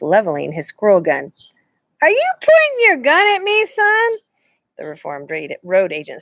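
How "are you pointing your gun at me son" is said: amused outlaw. A narrator's reading. Stranger faced with gun.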